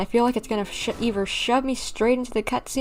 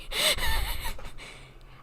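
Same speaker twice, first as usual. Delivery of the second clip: a bright high laugh of disbelief; high burst of laughter; 5 dB quieter than usual. Real, not acted. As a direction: laugh it off in amazement. Streamer laughs at game scene.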